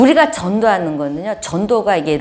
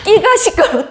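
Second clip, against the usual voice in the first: laughing; 12 semitones higher, 3 dB louder than usual. Real, not acted. Laughing at her own irony.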